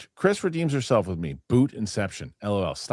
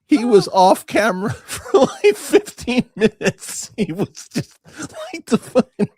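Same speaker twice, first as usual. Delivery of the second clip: laughing; 6 dB louder than usual. Real, not acted. Cracking up over a video.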